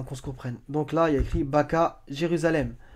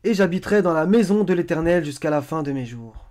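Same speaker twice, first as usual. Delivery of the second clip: loud, raised opening, easing down towards the end; declaimed verse reading; 4 semitones higher, 8 dB louder than usual. Acted. Presenter showing ce que David dit, declaimed reading. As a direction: proclaim the verse with force.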